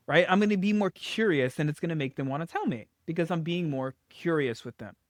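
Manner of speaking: ordinary delivery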